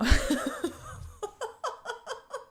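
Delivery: high burst of laughter; high delighted laugh. Real, not acted. Laughing at Texas moment.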